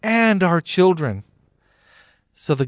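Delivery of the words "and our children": loud, high defiant yell; crowd yelling back. A narrator's reading. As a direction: throw the blame back at him. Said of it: "all the people yelled back".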